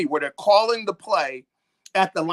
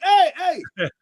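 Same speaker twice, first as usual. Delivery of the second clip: raised, higher-pitched mock call; calling out to a teammate; 9 semitones higher, 4 dB louder than usual. Linebacker hailing his safety for help, pretend calling out. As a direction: call urgently for the safety's attention, half in play. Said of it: "I'm telling the safety".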